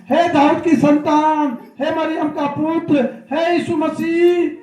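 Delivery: slow, drawn-out call to someone passing; long, pleading-style call. Retold or imitated. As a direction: imitate a beggar calling out, stretching each phrase. Blind man calls out to Jesus.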